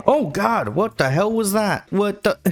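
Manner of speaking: high, sudden shocked cry; jolted by a surprise attack; startled outburst. Real, not acted.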